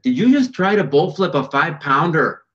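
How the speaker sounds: high, loud imitated Minnesota voice; Minnesota accent. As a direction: put on an incredulous Minnesota accent. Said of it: "in her Minnesota accent".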